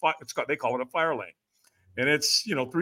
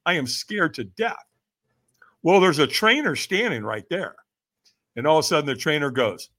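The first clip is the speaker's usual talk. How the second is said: ordinary talk, nothing marked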